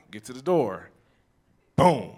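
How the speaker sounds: ordinary talk, nothing marked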